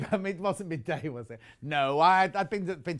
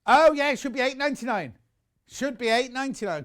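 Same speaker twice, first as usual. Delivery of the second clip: surprised correction; 7 semitones higher, 6 dB louder than usual. Real, not acted. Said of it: "Got confused then".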